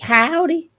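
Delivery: a hammed-up Southern twang on Howdy; light put-on country howdy; fake country accent. Shown as a demonstration. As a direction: drawl out howdy like a mock cowboy. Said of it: "In my little fake country accent".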